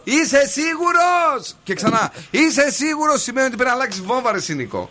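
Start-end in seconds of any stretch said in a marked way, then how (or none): 0.0-1.6 very high, hugely drawn-out call; mock shout. Shown as a demonstration. Host showing the caller his warning signal, long high mock shout. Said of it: "Όταν φωνάζω". 2.3-3.2 high call, less stretched out; mock shout. Shown as a demonstration. Host repeating his warning signal for the caller, high mock shout. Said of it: "Όταν φωνάζω".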